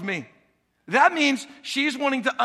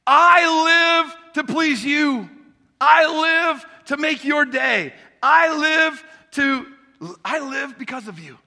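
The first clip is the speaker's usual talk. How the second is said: much higher, louder, slow devoted shout; dog declaring it lives for its owner; 7 semitones higher, 6 dB louder, slower than usual. Acted. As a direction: proclaim devotion like an adoring dog.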